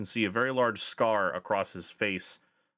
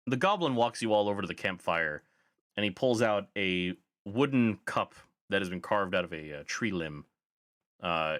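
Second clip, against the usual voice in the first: ordinary talk, nothing marked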